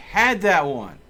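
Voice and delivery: loud, high, bitter shout; angry yell. Real, not acted. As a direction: shout it as if it was stolen from you. Still fuming after a long pause.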